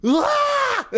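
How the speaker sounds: sudden high startled yelp; startled shriek. Retold or imitated. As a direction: shriek as if an icy hand grabbed you. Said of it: "and I go".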